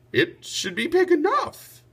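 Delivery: high, lightly voiced lady; a noble lady judging the tree; lady's voice. A narrator's reading. Lady Di speaks.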